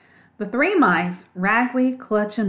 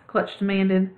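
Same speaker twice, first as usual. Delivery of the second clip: ordinary delivery, nothing marked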